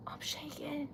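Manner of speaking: very soft, breath-only voice; overwhelmed whisper. Real, not acted. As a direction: whisper it, overcome. Overwhelmed by a gift.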